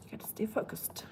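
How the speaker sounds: near-silent whisper, talking to herself; whispering a note to herself to stay focused. Real, not acted. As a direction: hush it as a private reminder to focus. Muttering to herself to refocus.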